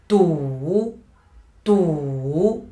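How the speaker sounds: "Du" drawn out in a low dip; falling-rising tone. Shown as a demonstration. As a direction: let each "Du" sink, then rise. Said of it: "Falling, rising".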